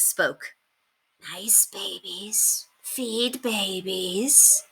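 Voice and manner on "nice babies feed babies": put-on 1 croaky old woman. A narrator's reading. Old woman in rags speaks.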